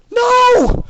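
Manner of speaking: very high, loud desperate scream. Acted. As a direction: scream to stop them. Cry of horror at capture.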